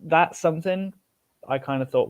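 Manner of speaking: normal talking voice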